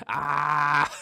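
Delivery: loud, drawn-out mock scream; imitated scream. Podcaster imitating a stuck caver's scream to his co-host, mock scream. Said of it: "and then he just screams".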